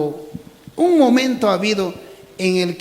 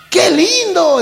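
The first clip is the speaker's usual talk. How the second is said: much higher and louder, thrilled; delighted cry; 11 semitones higher, 8 dB louder, slower than usual. Acted. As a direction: squeal it in delight. Animals admire flying frog. (grito de admiración)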